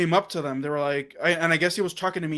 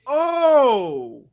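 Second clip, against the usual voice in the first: long, high, louder "oh"; imitated dawning realization; 8 semitones higher, 5 dB louder than usual. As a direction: mimic someone suddenly catching on. Retold man realizes his mistake.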